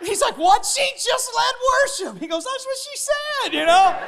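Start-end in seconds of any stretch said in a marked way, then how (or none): 0.4-2.2 high-pitched mock disbelief; incredulous. Cop reacts in disbelief. 2.4-3.5 high-pitched retold exclamation; exclaiming agreement. Cop answers in disbelief.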